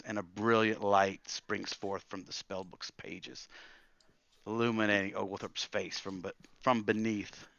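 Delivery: ordinary delivery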